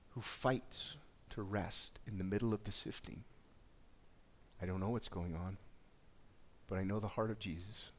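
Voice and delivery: low, quiet, searching pastoral voice; soft intimate appeal. Acted. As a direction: draw them in gently, almost confiding.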